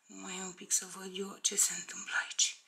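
fast, breathy whisper while checking the stitches; whispered to herself. Real, not acted. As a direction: mutter it to yourself under your breath. Checks her work, talking to herself. (șoptit pentru sine, repede)

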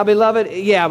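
ordinary talk

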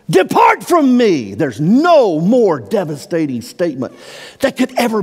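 high, loud pulpit preaching, pressing hard; fervent preacher at full cry; full-force preaching. Acted. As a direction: drive the scripture home from the pulpit. Preacher repeats God's verdict.

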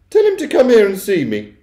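high, loud imperious order; shrill-pitched, bossy demand; demanding Missus Jenkins. A narrator's reading. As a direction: play an overbearing mother giving orders. Mother demanding to see her son.

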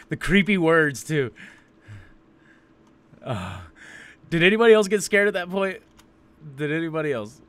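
loud, high, still rattled; shaken and loud. Real, not acted. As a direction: blurt it out, still scared. Still shaken after the scare.

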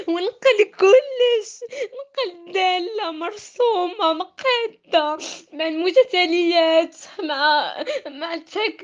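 tearful, indignant complaint; angry crying. Real, not acted. Upset student venting about cheating.

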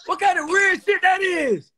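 high, loud and drawn out; baffled, calling out the weirdness; incredulous outburst. Real, not acted. Baffled by stranger joining live.

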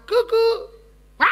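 very high, loud drawn-out frog call; frog call imitation. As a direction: go "coo-coo" as a frog, high and long. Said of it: "צפרדע עושה לך".

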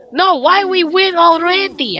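high, loud complaint; high-pitched gamer exasperation; exasperated protest. Real, not acted. Game ends too soon.

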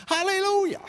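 high, sung-out hallelujah; celebrating the free drink, high exclamation; joyful hallelujah. Real, not acted.

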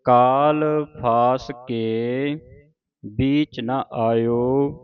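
slow, measured teaching recitation; scripture recitation. Shown as a demonstration. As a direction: draw each word out for students to follow. Reads scripture for students to follow.

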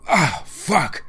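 high cry of pain; pained curse. Acted. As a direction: curse as if just landed hard. Character hurt after a fall.

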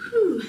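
high, loud exhaled "whew"; relieved whew. Real, not acted. Instructor finishing the last interval of a workout, relieved whew. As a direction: blow out a short whew of exertion.

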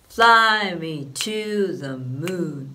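slow stretched "fly me to the moon" with long fly and short the; English rhythm demo. Shown as a demonstration. Teacher demonstrating English rhythm on "fly me to the moon", slow and stretched. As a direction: chant it slowly, fly held long. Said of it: "英語っぽい".

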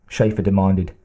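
usual speaking voice

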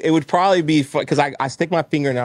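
usual speaking voice

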